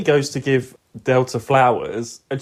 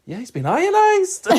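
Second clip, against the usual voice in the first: breezy, oblivious; 12 semitones higher, slower than usual. Retold or imitated. Quoting Ray, cheerful and oblivious.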